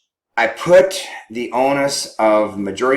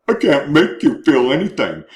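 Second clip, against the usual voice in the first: snide comeback to "you're making me feel"; a light mock sarcastic reply; sarcastic retort; 3 semitones higher than usual. Spouse throwing back a snide reply, lightly sarcastic. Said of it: "what's the sarcastic response to that one".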